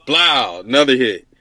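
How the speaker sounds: ordinary talk, nothing marked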